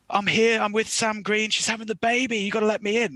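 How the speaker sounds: panicked plea to be let in; retold frantic plea. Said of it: "honestly like that".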